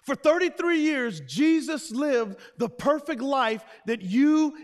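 loud, high preaching shout; full-force preaching. Acted. Preacher declares Jesus lived the perfect life, full-force preaching. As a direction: proclaim it at the top of your voice.

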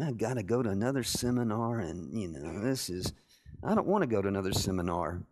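low, muttered mock complaint; cranky grumbling. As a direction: grumble sullenly under your breath. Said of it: "I'm just cranky going".

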